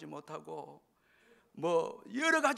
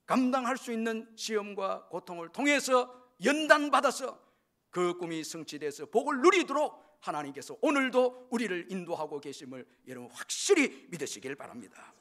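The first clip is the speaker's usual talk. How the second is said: raised, emphatic pulpit exhortation settling at the close; forceful preaching; 4 semitones higher, 7 dB louder than usual. Real, not acted. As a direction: urge the congregation with rising force, then land it. Preacher drives the point home. (힘주어 선포하는 설교조)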